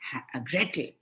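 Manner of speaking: ordinary delivery, nothing marked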